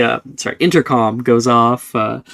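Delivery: usual speaking voice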